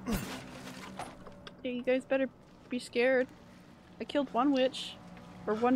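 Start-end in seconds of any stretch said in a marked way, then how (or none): 0.1-0.2 panicked muttering. Real, not acted. Panicked muttering while fleeing.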